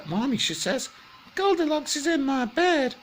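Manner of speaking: high squeaky cub voice tattling; a little bear telling on the intruder. Acted. Little bear tells Mummy about Goldilocks, high squeaky voice.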